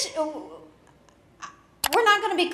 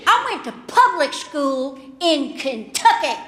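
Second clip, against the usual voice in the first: Kentucky comeback; 6 semitones higher, 5 dB louder than usual. Retold or imitated. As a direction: lay down the law, slowing on the verbs. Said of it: "And I said".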